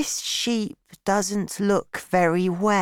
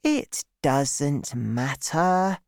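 put-on slow, drawn-out boy's reply; slowly; slower than usual. A narrator's reading. Boy brushing off the pilot's excuse, speaking slowly. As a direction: stretch the words out as a little boy. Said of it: "he said slowly".

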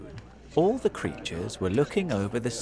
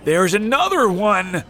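loud, high warning cry; alarmed shout; 9 semitones higher, 9 dB louder than usual. A narrator's reading. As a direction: yell the sighting to the crowd. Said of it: "shouted the most important firefighter".

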